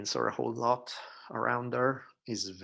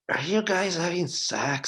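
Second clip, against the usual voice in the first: sleepy daughter; 9 semitones higher, 9 dB louder than usual. Retold or imitated. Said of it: "and she's like".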